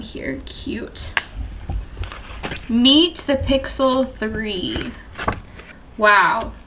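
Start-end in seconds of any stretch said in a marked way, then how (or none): 0.0-1.1 whispered. Real, not acted. Quiet aside while unboxing.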